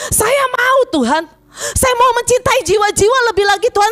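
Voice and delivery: high, insistent re-enacted pleading; fervent prayer. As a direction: implore God, holding the voice high. Acts out own fervent prayer.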